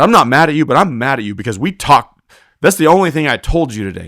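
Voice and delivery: angry scolding. Retold or imitated. Roper chewing out partner for not listening, angry scolding.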